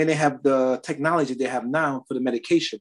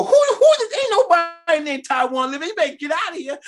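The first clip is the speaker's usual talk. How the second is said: much higher, loud scolding mom; angry mother; 13 semitones higher, 3 dB louder than usual. Retold or imitated. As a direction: shriek it like a suspicious mother. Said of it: "My mother go".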